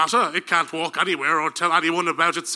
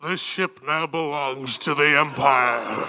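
deep villain voice; 5 semitones lower, 3 dB quieter, slower than usual. Acted. Darth Vader enters the ship.

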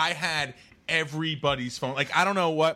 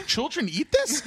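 disgusted disbelief; 12 semitones higher, slower than usual. Real, not acted. Disgusted at kids' food.

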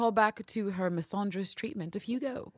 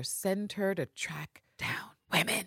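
slow conspiratorial whisper; slow whispered aside; 5 dB quieter, slower than usual. Real, not acted. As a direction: whisper it like a secret.

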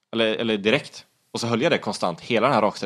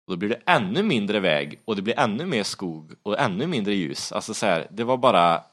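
ordinary talk, nothing marked

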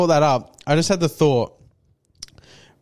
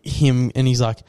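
usual speaking voice

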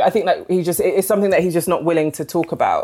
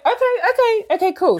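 much higher, quick string of okays to drop the subject; awkward backing off. Retold or imitated. She answers her private granddad, backing off awkwardly, much higher. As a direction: retreat fast and keep it light, pitched up high. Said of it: "And I was like".

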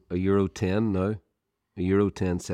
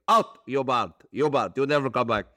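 high, angry Turkish shopkeeper banning; imitating a furious kebab man; Turkish accent; 5 semitones higher than usual. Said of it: "sort of Turkish guy".

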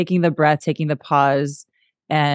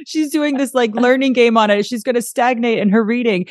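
mock panic; 9 semitones higher than usual. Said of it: "for him, like".

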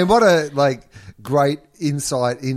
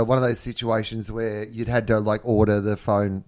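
normal talking voice

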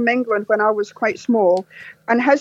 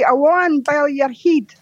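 slow, broad Glasgow Awan bel your head; slow Glasgow dialect; Glaswegian phrase; 2 semitones higher, slower than usual. Shown as a demonstration. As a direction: drawl it out like a Glaswegian insult. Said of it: "you say".

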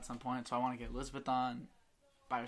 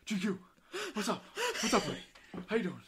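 loud, high, goofy bro greeting; hammed-up big guy voice; big goon voice; 8 semitones higher, 3 dB louder, faster than usual. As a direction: play a big friendly goon. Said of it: "he was just being, like, a big goon".

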